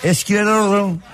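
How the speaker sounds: high sing-song junk call; melodic street vendor shout. Retold or imitated. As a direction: croon it like a street junk buyer. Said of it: "Eskici yapardım sana". (melodik eskici bağırışı)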